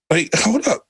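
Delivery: high, startled "hold up" in mock disbelief. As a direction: pull up short, pretending shock. His reaction to friends' judgment.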